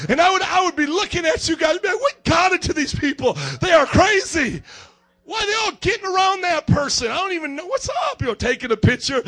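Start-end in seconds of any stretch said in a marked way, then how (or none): 1.8-8.2 high, loud incredulous outburst; loud bafflement. Acted. Preacher acts out watching his fawning friends, loudly baffled. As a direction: act appalled and baffled, voice raised.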